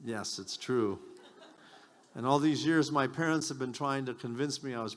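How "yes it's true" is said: usual speaking voice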